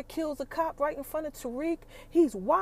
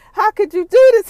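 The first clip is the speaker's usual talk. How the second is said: loud, high betrayed yell; anguished outcry; 8 semitones higher, 17 dB louder than usual. Retold or imitated. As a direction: shout it at Tasha in disbelief. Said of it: "Ghost is like".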